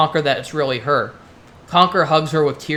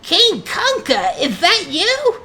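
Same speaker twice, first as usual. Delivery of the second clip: very high, drawn-out grating squeal; nails-on-chalkboard screech; slower than usual. Acted. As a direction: screech it slowly across to the next cell. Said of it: "A nails on a chalkboard voice screeched".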